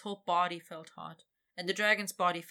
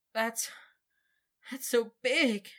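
higher, louder, slow broken stammer; 5 semitones higher, 5 dB louder, slower than usual. Acted. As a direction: stumble over the words, overwhelmed. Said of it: "he stammered stupidly".

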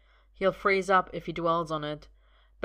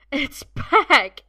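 giddy; 9 semitones higher, 13 dB louder than usual. A narrator's reading. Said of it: "he says giddily".

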